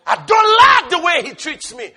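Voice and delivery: loud, high mock complaint; whiny grievance. Preacher imitating a believer's grudge, loud and high. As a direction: whine about being mistreated. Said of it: "Are you listening to me?".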